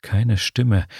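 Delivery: plain reading voice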